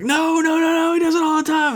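imitating onlookers, very high and rushed; squeaky mock pleading in a hurry; retold panicked protest. Everybody reassuring the red-faced lady, mock panicked protest.

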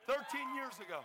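THIRTEEN YEARS AGO high, loud and proud; celebratory shout; triumphant repeat. Real, not acted. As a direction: proclaim the repeat to the crowd. Triumphant repeat to cheering crowd.

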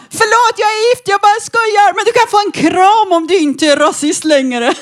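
sweet mock apology. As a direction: play it coy and sugary to the thug. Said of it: "Så jag bara".

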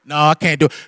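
ordinary delivery

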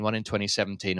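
ordinary talk, nothing marked

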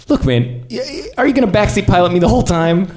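high, fast, loud irritated snap; annoyed protest. Acted. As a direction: push back at the backseat pilot. Annoyed at backseat pilot.

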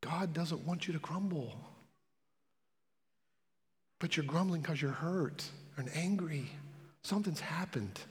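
quiet, caring appeal to grumblers who are hurting; gentle pastoral tone. Real, not acted. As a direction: coax them gently toward help. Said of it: "I'm trying to be gentle".

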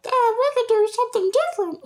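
very high inner-voice nag; very high critic voice nagging from the back of the mind. Retold or imitated. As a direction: play the little voice in your head. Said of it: "there's a little voice going like".

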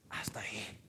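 low whisper; hushed. Real, not acted. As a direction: drop to a solemn whisper. Drops to a hush after loud thanks. (susurro bajo y solemne)

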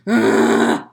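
loud, low groan; annoyed wake-up groan. Retold or imitated. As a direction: groan like someone disturbed in their sleep. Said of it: "I'll literally go".

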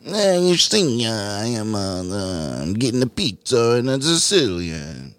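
mock Italian New Yorker accent; slow, hammy Italian-American voice. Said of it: "doing impersonations of somebody".

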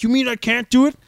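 mocking anti-mask protesters, indignant yell; mock indignant patriot. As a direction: ham up an outraged freedom lover ending in a yelled U S A. Mocking freedom-shouting protesters.

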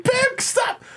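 very high, hammed-up protest; mock pleading yell. Imitating parents begging Bam to quit, high mock yell. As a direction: cry out like a helpless parent.

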